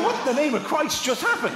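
high-pitched mock bewilderment; bereft bafflement. Guest left bereft in the bedroom, high bewildered cry. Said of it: "bereft in the middle of the bedroom".

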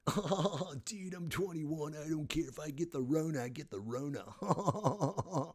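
put-on airheaded young party-goer, laughing it off; mock carefree partier. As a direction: play the clueless spring breaker. Mocking carefree twenty-one-year-old.

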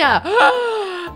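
high gasp of surprise; excited gasp. Real, not acted. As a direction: gasp as if spotting a star. Spots a celebrity in the game.

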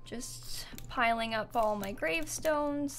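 soft whispered aside while placing graves. Real, not acted. To viewers while piling gravestones, whispered. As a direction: breathe it under the breath.

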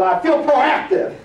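high, slow burst of energy; energetic proactive. Retold or imitated. Trainer greeting his friend Bill at dawn, high energetic declaration. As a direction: pump it up. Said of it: "like that".

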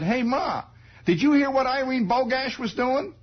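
put-on high, chirpy boy voice; high innocent kid asking his mother. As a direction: ask Ma brightly, clueless.

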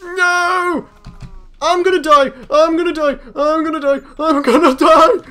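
high, loud panicked screaming; terrified horror victim. Real, not acted. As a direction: scream it as if about to be caught. Fleeing the monster, repeated screams.

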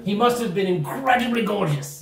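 high, swooning praise; gushing gnome. Acted. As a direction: gush theatrically over the mystery giver. Gnome praises himself unknowingly.

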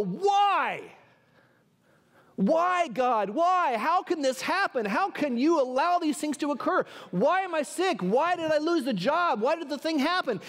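high, insistent cries to God; acted lament, each question pushed up in pitch; anguished questioning. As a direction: plead upward, demanding an answer. Acts out people questioning God in distress.

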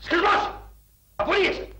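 furious bark. Acted. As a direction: slam the firing down hard.

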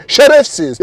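high, loud burst of insult; genuinely angry. Real, not acted. Driver ranting at those who complain, angry. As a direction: spit out the insult in real irritation. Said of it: "harbiden sinirlendim ya".

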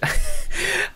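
high burst of laughter; amused laugh. Real, not acted. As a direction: crack up at a pun. Gets the loan shark pun.

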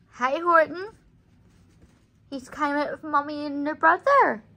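ordinary talk, nothing marked

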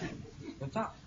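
hushed, voiceless "ما ينفعش"; whispered aside. Real, not acted. Lecturer whispering an aside to the students. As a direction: say it under your breath. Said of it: "aside to students".

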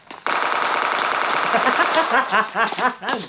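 long, rattling burst of laughter. Real, not acted. Streamer amused by the chat, laughing.

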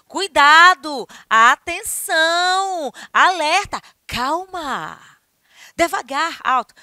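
loud, slow, high alarm; warning call. Shown as a demonstration. Teacher demos warnings with strong intonation. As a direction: shout out warnings. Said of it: "estou falando com entonação".

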